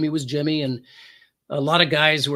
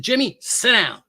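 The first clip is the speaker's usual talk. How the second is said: raised, higher-pitched order; firm command; 6 semitones higher, 4 dB louder than usual. Retold or imitated. Officer ordering a suspect to sit, firm command. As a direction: order him down like a cop in charge.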